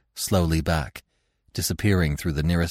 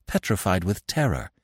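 usual speaking voice

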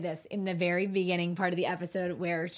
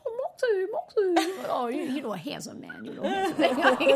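put-on high, loud baby-talk to a pet; dog voice; 9 semitones higher, 4 dB louder than usual. Shown as a demonstration. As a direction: coo at your wiener dog. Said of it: "That's my dog voice".

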